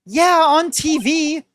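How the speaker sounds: drawn-out high mocking tone; high slow sarcastic voice. Shown as a demonstration. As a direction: stretch it out, high and snide. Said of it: "Oh, no. No. You can't say it like that".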